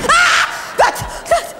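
sudden high shriek of fright; frightened scream. Acted. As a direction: scream out, startled. Alone in woods, sudden fright.

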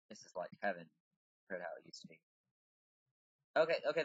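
ordinary delivery

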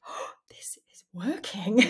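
breathless stunned whisper, hardly voiced; awed whisper. Recalling her first sales, a stunned whisper. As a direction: whisper it like you can't believe it. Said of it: "I was flabbergasted".

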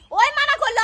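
angry protest. Acted. Blaming the other who forbade it, angry protest. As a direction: protest loudly against the blame.

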